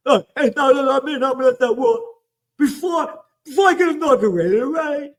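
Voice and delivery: a cartoonish high huckster's voice selling the promise; mock politician. Mocking the president's campaign promise in a high, laughing huckster voice.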